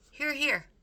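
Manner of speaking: Cook's voice. A narrator's reading. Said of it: "said Cook".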